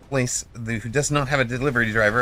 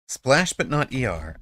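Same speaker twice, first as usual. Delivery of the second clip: normal talking voice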